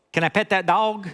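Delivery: put-on high little-girl Southern twang; little girl's Southern drawl. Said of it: "this little girl goes".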